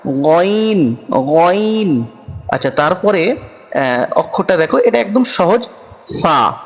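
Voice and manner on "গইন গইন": গইন said with a throaty Arabic ghayn sound; গইন with a guttural Arabic gh; Arabic letter name. Shown as a demonstration. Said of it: "এই যে অক্ষরটা".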